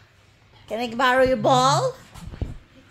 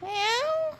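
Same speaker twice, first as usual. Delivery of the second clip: mock cat meow; 14 semitones higher, 4 dB quieter than usual. Teasing dog with cat noise.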